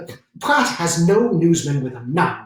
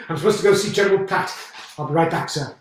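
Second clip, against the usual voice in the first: normal talking voice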